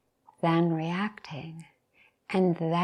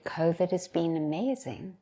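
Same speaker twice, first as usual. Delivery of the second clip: normal talking voice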